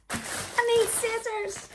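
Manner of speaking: high, slow sing-out; calling out. Real, not acted. Vlogger asking for scissors, high call-out.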